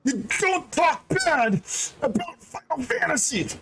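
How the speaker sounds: high-pitched mock rant, hammed up; mock angry yell. Yoshi P scolding the streamer for badmouthing the game, mock yell. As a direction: play Yoshi P yelling at the streamer, high and hammy. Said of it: "Yoshi P came in and he said".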